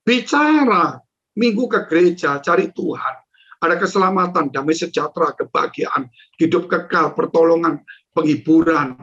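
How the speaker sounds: ordinary talk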